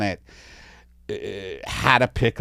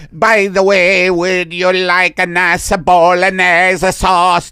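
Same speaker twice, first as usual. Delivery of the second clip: slow, loud, higher Italian; Italian alien accent; 7 semitones higher, 7 dB louder, slower than usual. Pretend. Italian alien offering bolognese, hammed-up Italian accent. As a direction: offer the sauce in thick Italian. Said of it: "That's the Italian alien".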